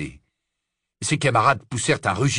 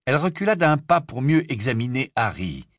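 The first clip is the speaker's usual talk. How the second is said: ordinary delivery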